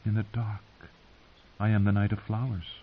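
softly, as if from memory; hushed remembered voice. A narrator's reading. Husband to his bride on wedding night, hushed.

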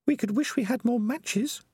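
put-on high, earnest young rat girl; Peaches, pleading and firm; young female rat voice. A narrator's reading.

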